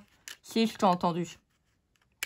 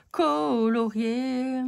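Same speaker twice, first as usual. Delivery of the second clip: colorié stretched slowly, every syllable spelt out; colorié, slow and careful, as if triumphant; drawn-out word; much slower than usual. Shown as a demonstration. Finally getting the word right.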